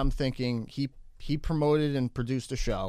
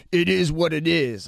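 louder, mock tough-guy threat; tough-guy menace; 4 semitones higher, 7 dB louder than usual. Imitating the gun-pulling producer as a mock tough guy. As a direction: ham up a cartoon tough guy. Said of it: "he just starts going like".

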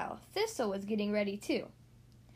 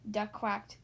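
usual speaking voice

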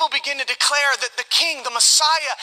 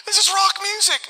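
high-pitched mock excitement; jokey onlooker; 9 semitones higher than usual. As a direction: deliver the pun high, in a put-on voice. Dad joke in onlooker's voice.

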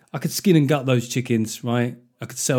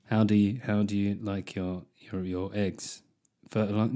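halting, low seduction, played for laughs; mock chat-up; 4 semitones lower, 6 dB quieter than usual. Man chatting up a woman over breakfast, mock seductive. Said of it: "do this kind of chat with women".

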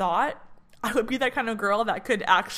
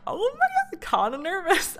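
nervous; 10 semitones higher than usual. Retold or imitated. Before Barry's boot camp, admitting her nerves: high nervous squeal. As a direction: squeak it out, half laughing at your nerves. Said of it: "so I'm like".

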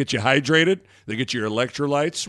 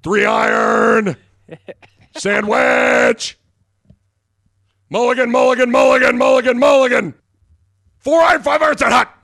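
mock quarterback cadence; 9 semitones higher, 6 dB louder than usual. Host playing quarterback on the golf course, shouted cadence. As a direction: holler it like calls at the line of scrimmage.

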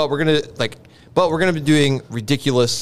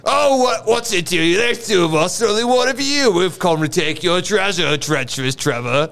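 cocky Aussie challenger, lively and raised; Australian adventurer; 5 semitones higher than usual. Acted. Australian confronting a pirate over his treasure, cocky. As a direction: taunt like a brash Australian.

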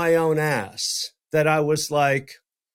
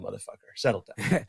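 quick, softer, lower aside to himself; muttered to self; 7 semitones lower, 7 dB quieter, faster than usual. Real, not acted. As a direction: scold yourself under your breath. Repeats advice to himself.

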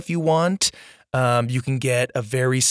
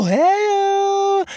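excited greeting; 3 dB louder than usual. Real, not acted. Shout out to patrons.

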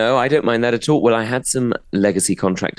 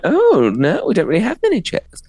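posh shop lady; 14 semitones higher than usual. Retold or imitated. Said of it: "We saw the one like".

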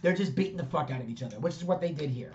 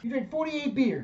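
retold amazement; 8 semitones higher than usual. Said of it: "He's like".

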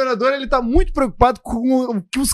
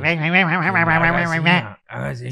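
put-on old man's voice; 3 semitones lower, slower than usual. Host showing how the short old man sounds, softening at the end. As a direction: lightly mimic the little old man calling, trailing off. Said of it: "ele geralmente tem a voz assim".

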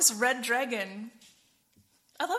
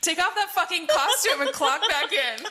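laughing mock scold; 5 semitones higher, 5 dB louder than usual. Mocking angel quote laughing.

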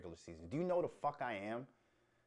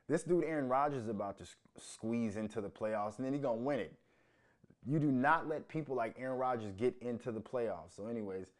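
ordinary delivery

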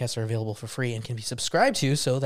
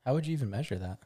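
ordinary talk, nothing marked

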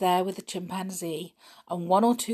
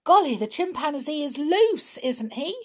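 high eager exclamation; thrilled child pointing out; high and loud with excitement; excited child; 10 semitones higher, 5 dB louder than usual. Acted. Children spotting chimp, voiced.

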